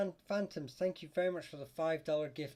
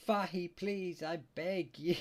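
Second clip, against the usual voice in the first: higher, louder plea breaking into laughter; amused mock pleading; 7 semitones higher, 5 dB louder, slower than usual. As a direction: read the plea out, cracking up at it. Reads a viewer's pleading request, amused.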